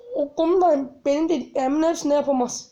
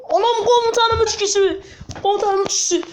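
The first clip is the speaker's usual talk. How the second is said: alarmed shout; 6 semitones higher than usual. Real, not acted. Alarm at three enemies.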